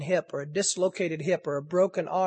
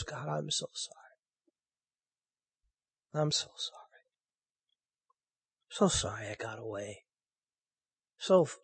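soft, hushed prayer of repentance; a hushed, sorry confession to God; quiet prayer; 4 semitones lower, 8 dB quieter than usual. Acted. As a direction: pray it quietly, almost to yourself. Prays aloud in repentance.

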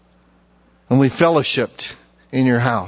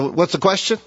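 ordinary delivery

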